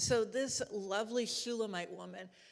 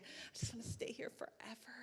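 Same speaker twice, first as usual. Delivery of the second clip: dreamy whisper; 11 dB quieter than usual. Acted. Bride voiced, lingering in love.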